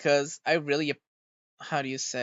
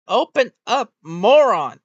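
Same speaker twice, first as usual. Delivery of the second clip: loud and higher, exasperated, hitting moron hardest; furious shout; 6 semitones higher, 10 dB louder, slower than usual. Real, not acted. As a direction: bellow the insult at the frozen model. Yelling at avatar's closed eyes.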